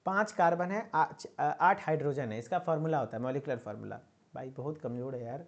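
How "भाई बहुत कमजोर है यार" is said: muttered aside. Real, not acted. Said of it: "aside about a weak student".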